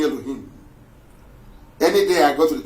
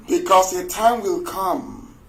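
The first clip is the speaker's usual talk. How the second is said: ordinary talk, nothing marked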